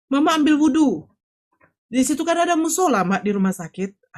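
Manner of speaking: urgent pleading. Retold or imitated. Daughter urging her despairing mother to go and pray, pleading. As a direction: coax insistently, imitating the phone plea.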